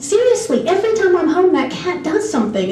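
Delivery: high, emphatic retold protest; worked-up, high-pitched protest; exasperated insistence. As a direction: imitate pleading to be believed. Retold insistence to host family.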